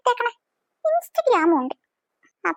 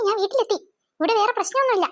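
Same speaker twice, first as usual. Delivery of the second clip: ordinary talk, nothing marked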